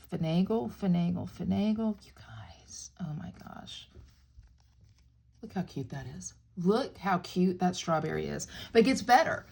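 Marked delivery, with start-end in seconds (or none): 2.1-6.3 breathless real whisper, much lower and softer; excited whisper. Real, not acted. Crafter showing viewers the cute strawberry, excited whisper. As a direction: hush it like a secret delight.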